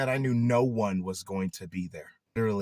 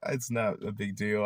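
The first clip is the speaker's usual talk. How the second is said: ordinary talk, nothing marked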